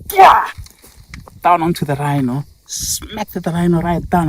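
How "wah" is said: loud, high burst of effort; striking cry. Acted. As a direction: yell it as the elephant slams down. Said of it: "he just went like".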